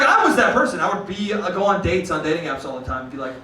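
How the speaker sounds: normal talking voice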